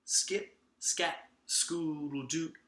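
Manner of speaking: usual speaking voice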